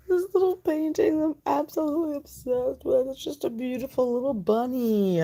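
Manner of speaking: a touch of sweet gushing; gushing delight. Real, not acted. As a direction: gush over it like a treasure. Gushing over a painting.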